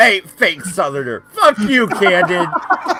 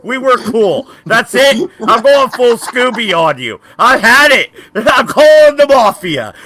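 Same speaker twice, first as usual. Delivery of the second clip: hammed-up fury, higher and a bit louder; mock rage; 7 semitones higher, 4 dB louder than usual. Fake-angry gamer yelling at a friend who mocks him.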